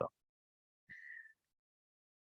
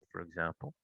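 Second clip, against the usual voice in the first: softer repeat; 5 semitones lower, 15 dB quieter than usual. Real, not acted. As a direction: drop the repeat almost to nothing. Repeats phrase, second softer.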